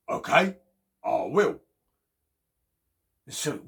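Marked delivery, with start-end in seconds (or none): 0.1-1.6 louder bear reply; bear agreeing. Acted. Bear agreeing to Chipmunk, louder. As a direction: bluff a brave yes. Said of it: "so he said".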